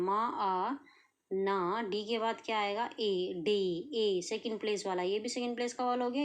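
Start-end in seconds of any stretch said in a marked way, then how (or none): 0.0-0.8 मा आ with the आ sound drawn out long; stretched vowel. Shown as a demonstration. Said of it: "आ का sound आ रहा है". (मा आ, आ की आवाज़ खींचकर)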